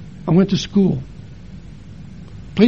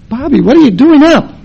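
startled dad; 8 semitones higher, 7 dB louder than usual. Retold or imitated. Dad surprised son awake.

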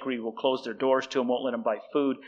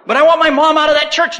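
very high, loud mock wail; hammed-up desperate plea; pleading protest; 13 semitones higher, 11 dB louder than usual. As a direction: imitate an impassioned student objecting. Student protests when told to stop.

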